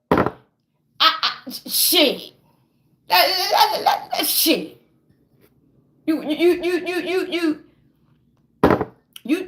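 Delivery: stammering, words failing; sputtering outrage. Acted. Betrayed speaker tries to answer a demand to forgive, sputtering. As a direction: stutter on one word, too worked up to finish. Too outraged to finish the sentence.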